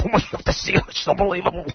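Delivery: soft, high breathless swooning; mock awe. Name dropper raves about an actress, mock hushed awe. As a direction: imitate hushed star worship.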